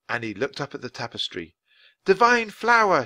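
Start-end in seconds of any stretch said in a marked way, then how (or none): 2.0-3.0 high, loud call across the room. Acted. Calling to the unicorn in the tapestry, high and loud. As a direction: Annie calls out the unicorn's name. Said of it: "she called".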